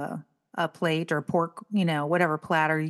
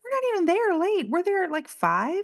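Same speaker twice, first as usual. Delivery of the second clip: much higher outraged complaint; indignant; 11 semitones higher than usual. Real, not acted. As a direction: protest at the restaurant.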